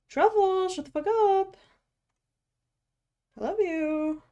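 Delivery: high singsong to a cat; affectionate high pet-talk; cooing at pet. Real, not acted. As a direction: scold the cat in a sweet high voice.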